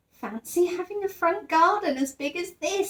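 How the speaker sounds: lightly voiced bear, full of wonder; Paddington's voice. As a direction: marvel like an innocent young bear. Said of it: "said Paddington".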